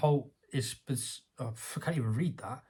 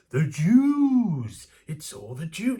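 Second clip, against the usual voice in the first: high, loud blurted crank voice; crank outburst; 6 semitones higher, 9 dB louder, much slower than usual. Retold or imitated. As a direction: pop up like a crank. Voicing a conspiracy crank blurting.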